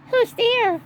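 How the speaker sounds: high, squeaky little mouse voice; mouse crying out. A narrator's reading. Mouse calling to glowing eyes in the den, squeaky cry. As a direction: squeak it out in alarm. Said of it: "Mouse cries".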